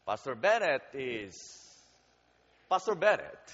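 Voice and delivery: ordinary talk, nothing marked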